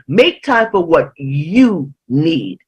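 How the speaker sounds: slow, spaced line with YOU held; deliberate weight on "you"; stress on YOU. Real, not acted. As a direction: set "you" apart before "need". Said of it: "the emphasis on you".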